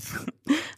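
quick unvoiced chuckle at a slip; soft breathy laugh. Real, not acted. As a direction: laugh under the breath at a stumble.

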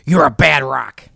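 slow, pointed scold; slow, reproachful jab at the rock. Acted. Coyote scolds the rock, slow and pointed. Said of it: "Coyote said".

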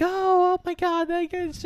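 high-pitched mock clamor; imitated crowd excitement. Crowd once they reach the ground, imitated excitement. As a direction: squeal like an astonished onlooker. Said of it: "everyone's like".

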